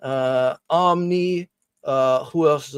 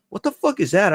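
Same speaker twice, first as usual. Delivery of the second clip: sudden high, louder what-the-fuck; startled outburst; 7 semitones higher, 4 dB louder, faster than usual. Retold or imitated. Reacting to buzzing watch notifications, startled. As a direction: jolt of retold surprise.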